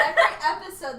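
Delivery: loud laughing fast talk; laughing while explaining. Real, not acted. Laughing through the sentence.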